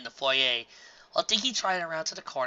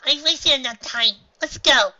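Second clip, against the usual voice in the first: high, resolved filly voice; determined filly; 11 semitones higher, 9 dB louder, slower than usual. A narrator's reading. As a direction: steel yourself and go.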